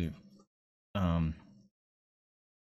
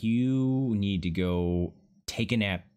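usual speaking voice

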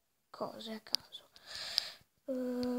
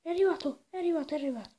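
excited; 4 semitones higher, 6 dB louder, much faster than usual. Real, not acted. Dog finally arrives.

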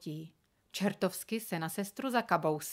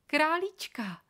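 higher, louder, thrilled; delighted little girl; 9 semitones higher, 4 dB louder, slower than usual. A narrator's reading. Girl thrilled to hold her new rabbit, delighted. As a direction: coo over the bunny with joy.

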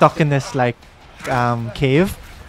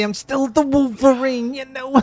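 high, slow hammed-up Italian Wolverine voice; mock Italian accent; 11 semitones higher than usual. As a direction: drawl it like a cartoon Italian hero. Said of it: "As I say".

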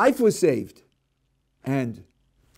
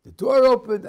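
much higher and louder, breathless; excited retelling; 8 semitones higher, 8 dB louder than usual. Acted. Rescued driver recalling the crash, loud and excited. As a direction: blurt it out, still shaken by the rescue. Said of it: "One is".

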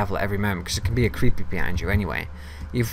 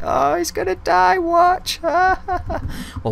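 high, slow mocking sing-song; mocking laughing viewers; 3 dB louder, much slower than usual. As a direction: taunt like a gloating onlooker. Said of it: "sitting here laughing like".

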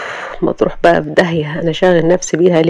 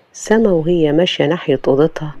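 ordinary delivery, nothing marked

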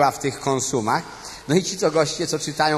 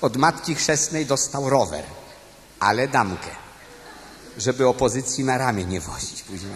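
ordinary talk, nothing marked

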